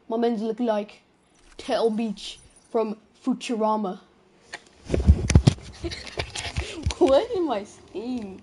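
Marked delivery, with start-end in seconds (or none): 4.8-6.9 high, breathless wheeze of laughter; wheezing laugh. Real, not acted. Laughing at his own joke.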